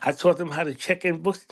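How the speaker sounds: usual speaking voice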